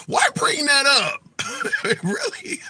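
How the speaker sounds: high-pitched, amused disbelief breaking into laughs; laughing incredulously. Real, not acted. Host scoffing at a commenter's Shakespeare point, laughing incredulously. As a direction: laugh through the question, voice jumping up.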